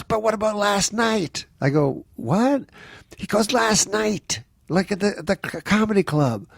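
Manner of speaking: exasperated casting director. Retold or imitated. Casting director berates Norm.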